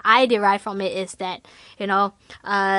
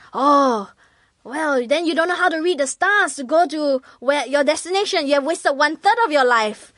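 lofty professor; 6 semitones higher than usual. Acted. Professor voiced in parable.

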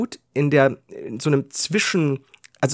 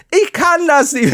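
higher, slow whine; sulky whine to the woman with the poker; whining; 10 semitones higher, 4 dB louder than usual. Retold or imitated. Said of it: "Ich war am Jammern".

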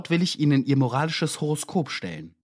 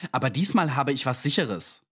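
usual speaking voice

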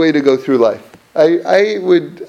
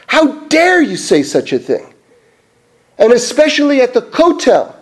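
retold indignant rebuke; 6 semitones higher than usual. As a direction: act out the old man scolding with raised pitch. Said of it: "he starts yelling at him".

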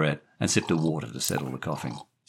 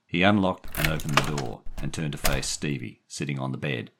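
plain reading voice

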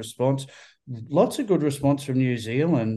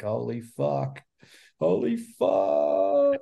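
awed; much slower than usual. Retold or imitated. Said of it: "I'm like".

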